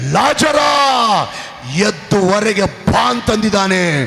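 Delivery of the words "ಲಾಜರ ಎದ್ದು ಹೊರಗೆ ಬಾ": commanding call. Retold or imitated. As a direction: summon the dead from the tomb. Jesus calls at the tomb.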